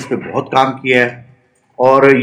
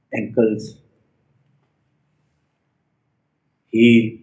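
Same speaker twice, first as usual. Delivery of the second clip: guided meditation; 4 dB quieter than usual. Real, not acted. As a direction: lead a body scan one short phrase at a time.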